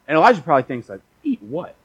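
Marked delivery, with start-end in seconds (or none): 1.2-1.8 high-pitched mock incredulity; grumpy disbelief. Elijah to the angel who says eat, grumpy. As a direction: play a grumpy, puzzled sleeper. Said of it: "he was kinda grumpy".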